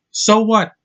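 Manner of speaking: normal talking voice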